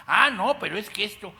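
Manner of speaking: high, loud mocking retort; scoffing excuse. A scoffer brushing off scripture, mocking and high. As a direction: mimic a scoffer brushing off the Bible. Scoffer dismissing God's word.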